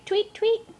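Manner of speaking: tweet squeaked very high. Shown as a demonstration. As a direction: chirp tweet up high like a bird. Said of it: "what a birdie says".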